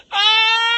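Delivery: sudden high yelp of panic; a nervous flyer's short high shriek; tense yelp. Retold or imitated. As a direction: squeal like a passenger who can't take it. Mimicking a tense passenger's yelp.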